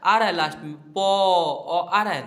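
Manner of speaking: teacher pointing out the trailing a; loud stressed explanation. Shown as a demonstration. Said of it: "कोई एक अक्षर को उच्चारण करके अपने माउथ से देखिए".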